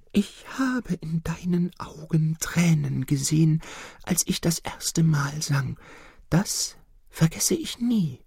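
the nightingale's high hushed reply; nightingale whisper. Acted. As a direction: whisper it tenderly as a small bird. The nightingale answers softly.

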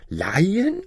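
high, rising echo of a request; incredulous refusal. Retold or imitated. Wife mimics the stingy neighbour's reply, incredulous. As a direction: throw the word back as a question.

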